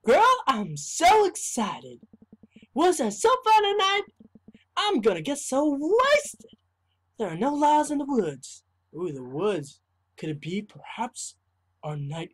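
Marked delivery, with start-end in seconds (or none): 0.0-8.6 put-on high, bubbly party-girl voice; girlish voice. Friend gushing to Greg about the woods, in a high girlish voice. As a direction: gush like an excited teen girl. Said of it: "I'll make a more girlish voice".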